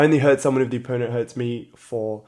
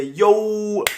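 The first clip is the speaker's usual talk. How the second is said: normal talking voice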